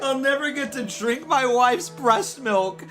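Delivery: high, slow mock crying whine; hammed-up whiny sobbing. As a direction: overplay a pathetic blubbering wail. Mock self-pity bit, high pitch.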